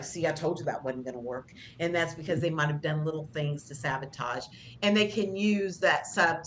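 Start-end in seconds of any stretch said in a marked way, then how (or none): 0.0-1.5 lower, softer told-you-so; mock passive-aggressive muttering; smug mutter. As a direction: gloat under your breath. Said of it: "It's like".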